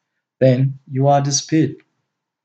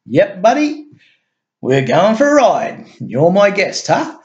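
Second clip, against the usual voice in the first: higher, mocking and smug; scoffing; 6 semitones higher than usual. A narrator's reading. Guard to Rex on the way to the car, scoffing. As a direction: talk down to a harmless visitor. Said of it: "scoffed the chubby young guard".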